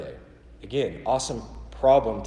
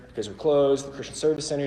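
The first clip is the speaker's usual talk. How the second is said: normal talking voice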